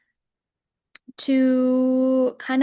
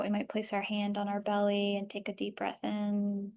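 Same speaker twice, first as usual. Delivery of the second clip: softer, even voice easing listeners through the body; guided body scan; 6 dB quieter than usual. Acted. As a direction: lead a calm breathing and body-awareness practice. Leads a body scan meditation.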